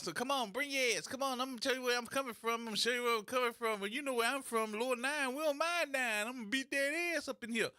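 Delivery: high, fast mocking imitation of a parent itching to fight; high-pitched fast mock challenge from a fighting parent; rowdy parent taunt. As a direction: ham up a brawling mom calling someone out. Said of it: "talking about".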